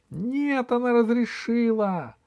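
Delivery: high, squeaky gnome voice; little gnome protesting. Acted. Gnome denies stealing from Алиса, high squeaky protest. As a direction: squeak out a denial.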